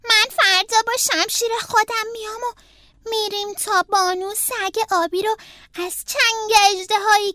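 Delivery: put-on high child voice building to a proud declaration; Franklin the eager knight. A narrator's reading. Franklin tells his friends tomorrow's rescue plan, proudly declaiming.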